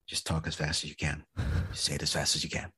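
low, hushed retold coaching; confidential advice. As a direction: quietly imitate a discreet tip before an audition. Casting director's private advice, retold.

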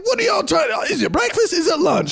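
high-pitched incredulous questioning; hammed-up comedian voice, way up high; mock stand-up bit. Acted stand-up bit over phone.

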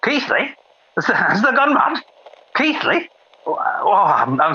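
high, shrill-pitched outrage; shocked disbelief. Acted. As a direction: recoil in horror at the name. Shocked outburst.

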